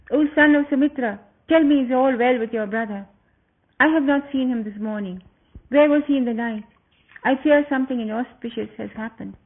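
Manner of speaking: agitated. Acted. Sita anxiously asks Lakshmana about her husband, agitated. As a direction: press him with worried questions. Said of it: "In an agitated voice she said".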